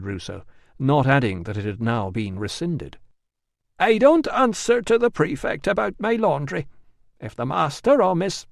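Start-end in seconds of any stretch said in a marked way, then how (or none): 3.8-8.5 high, brisk housekeeper voice; a servant standing her ground, pitched up; indignant housekeeper refusing. Acted.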